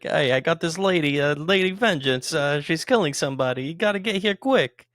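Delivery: loud, high New York mobster voice; bad New York accent. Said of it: "this weird New York accent".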